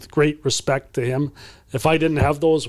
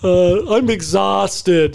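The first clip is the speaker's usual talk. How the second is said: very slow, high, whining complaint; whiny tired player; 6 semitones higher, 4 dB louder, much slower than usual. Retold or imitated. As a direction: coach mimicking a worn-out player's whining. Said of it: "you know, like this".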